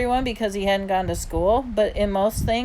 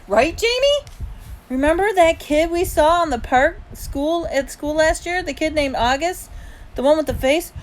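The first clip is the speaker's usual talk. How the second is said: high, louder eager questions; eager prompting; 9 semitones higher, 5 dB louder than usual. A narrator's reading. Boy prompts little brother to back him, eager. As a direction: press for agreement.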